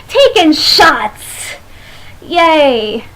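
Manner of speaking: slow, drawn-out ironic "Taking shots"; mock-celebrate a bad idea; sarcastic cheer. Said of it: "sarcastic about drinking".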